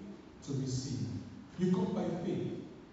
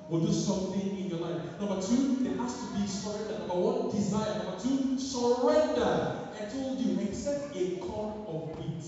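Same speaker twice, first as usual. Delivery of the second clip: ordinary delivery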